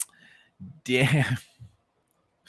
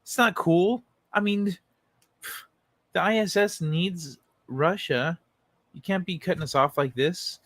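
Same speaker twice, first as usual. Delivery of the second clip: pitched-up mock whining of the official; lightly voiced pleading bully, higher; mock whining plea, higher; whiny plea; 6 semitones higher than usual. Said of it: "now he's like".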